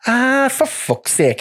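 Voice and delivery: slow, drawn-out exasperated groan; exasperated drawl. Retold or imitated. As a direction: drag it out like a fed-up boss. Voicing fed-up boss.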